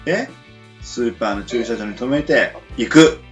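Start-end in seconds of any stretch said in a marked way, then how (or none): none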